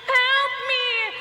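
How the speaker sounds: high, loud mock plea for help; calls out "Help! Help me!" like someone stranded in the snow; mock cry for help. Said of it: "being like".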